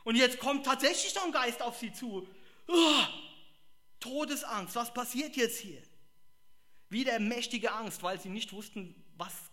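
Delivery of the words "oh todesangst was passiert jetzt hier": mock fright. Fear of the ghost.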